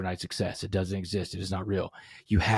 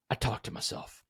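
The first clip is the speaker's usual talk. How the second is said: hushed; 9 dB quieter than usual. Shown as a demonstration. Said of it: "talks to himself".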